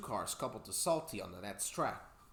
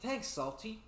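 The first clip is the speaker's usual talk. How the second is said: sad peep; 10 semitones higher, slower than usual. Acted. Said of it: "peeped Thomas sadly".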